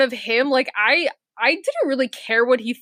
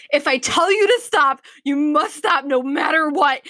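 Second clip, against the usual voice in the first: raised, higher mock scolding; retold yell; 4 semitones higher than usual. Road test examiner yelling at the crying driver to stop. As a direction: bark the order like a stern examiner. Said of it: "he started yelling at me".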